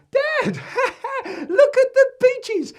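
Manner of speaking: put-on high, loud boy with a giggle; squealing excited child; calling out. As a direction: holler to Dad in giddy alarm. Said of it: "Joe is calling out".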